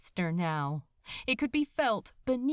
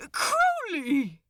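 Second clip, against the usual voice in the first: calling out; 7 semitones higher, 6 dB louder than usual. Acted. Aziraphale calling the unresponsive demon.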